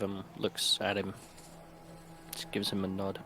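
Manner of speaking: ordinary talk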